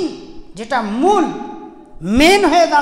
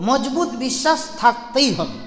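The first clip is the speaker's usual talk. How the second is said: ordinary delivery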